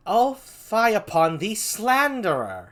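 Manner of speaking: indignant, high, drawn-out stage rebuke; Desdemona. Acted. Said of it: "That's a Desdemona".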